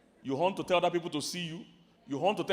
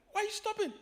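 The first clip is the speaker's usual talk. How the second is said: annoyed drivers; faster than usual. Retold or imitated. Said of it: "everyone will be like".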